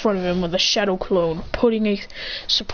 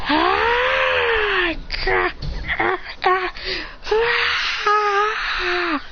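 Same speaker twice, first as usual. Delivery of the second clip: straining power cry; 10 semitones higher, much slower than usual. Acted. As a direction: scream as if unleashing chakra. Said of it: "he says".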